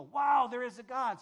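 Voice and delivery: loud, awestruck wow; amazed exclaim. Retold or imitated. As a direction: cry it out in wonder. Nations marvel at God's act.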